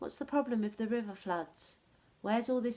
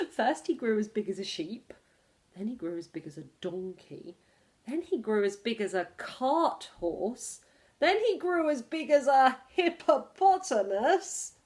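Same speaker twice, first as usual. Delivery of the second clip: building excitement; 7 dB louder than usual. A narrator's reading. Repeated growing list, building.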